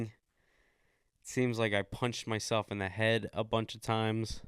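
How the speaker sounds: usual speaking voice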